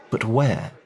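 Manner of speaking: ordinary delivery, nothing marked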